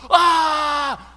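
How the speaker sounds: loud, high terrified "ah"; acted shriek of fright; scream of terror. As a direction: cry out as if seeing a ghost.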